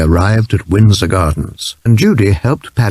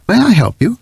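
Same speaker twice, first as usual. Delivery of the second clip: high, eager little-bear offer; polite little bear; 12 semitones higher, faster than usual. A narrator's reading. Bear offering help to the Browns, high little voice. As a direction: lift the voice up high like a small bear. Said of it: "it said".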